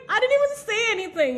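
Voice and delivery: ordinary delivery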